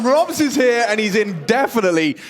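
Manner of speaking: high, loud hype call; esports caster. Acted. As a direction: hit the peak of the play.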